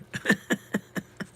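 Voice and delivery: quick voiceless chuckle; real, rapid run of chuckles; laugh. Real, not acted. Streamer hears a new one from chat, chuckling.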